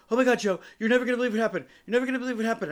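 high, loud, rushed panic; imitated frantic screaming. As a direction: blurt it out like a frantic caller. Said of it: "screaming at the top of her lungs".

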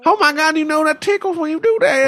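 high squealing tickled voice; being tickled. Acted. Voicing the tickled woman on video.

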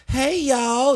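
high-pitched, loud Texan greeting; mock Texas accent. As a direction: put on a folksy Texas twang. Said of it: "what accent can I do?".

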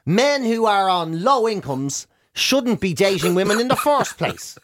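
normal talking voice

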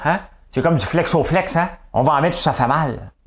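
high, loud mock politician; Denis Coderre. Host quoting a politician's other line, high loud imitation. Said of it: "il dit là, là".

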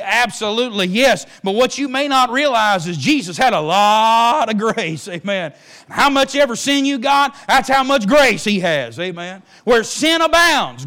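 loud, high, driving sermon delivery; fiery preaching. Acted. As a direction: preach it out to the congregation at full force. Preacher builds to full force.

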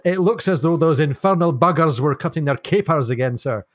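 amateur Scottish ship's master, lightly acted; mock-Scottish naval report; Scots accent. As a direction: put on a rough attempt at Scots. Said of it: "the master with the Scots accent".

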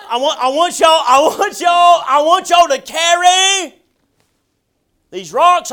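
booming preacher playing Joshua calling out orders; commanding shout. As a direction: roar the command at the men like a general. Joshua commanding the twelve men.

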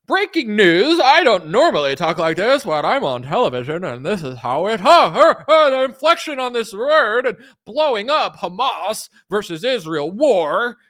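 high, loud parody anchor with exaggerated swoops; hammed-up breaking-news delivery; TV news inflection. Shown as a demonstration. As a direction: punch words like an alarmist newscaster. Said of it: "doing this inflection with their voice to, like, scare you".

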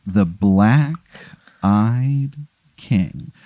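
very slow, portentous naming; ominous reveal. Acted. Game master reveals the arch rival, ominously and slowly. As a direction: draw it out with heavy pauses. Ominous repeat of villain's name.

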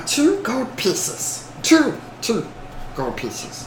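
slow, soft creature haggling; name the price slowly, in a small creature voice; Gollum. Acted. Goblin quoting a price, Gollum voice. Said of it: "I should do Gollum voice".

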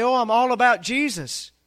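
mocking quote. Preacher imitating fair-weather believers, mock-pious sing-song. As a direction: parody a shallow believer. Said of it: "How many people say".

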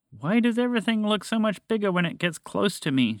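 high-pitched plaintive plea; pleading. A narrator's reading. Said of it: "she pleaded".

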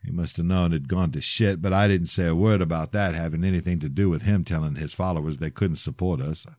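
plain reading voice

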